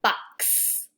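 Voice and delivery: Box with a long whispered ks ending; stressed ending. Shown as a demonstration. Teacher stretching the x ending for kids. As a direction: lean on the ks at the end of Box. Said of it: "Do you hear it at the end?".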